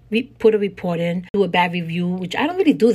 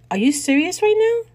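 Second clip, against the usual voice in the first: pitched high, indignant disbelief; retold shocked comeback; incredulous retort; 11 semitones higher than usual. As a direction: fire it back, high and outraged. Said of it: "I was like".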